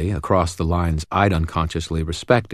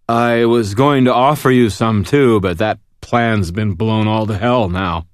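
put-on drunk voice; 4 semitones higher, 5 dB louder than usual. A narrator's reading. Drunk Thomas, bottle smashed.

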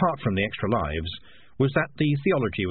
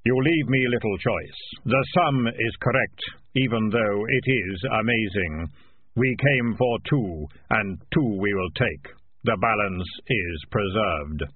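slow, measured voice of Death; solemn, deliberate Grim Reaper; slower than usual. Acted. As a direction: pronounce it slowly as Death. Death speaking.

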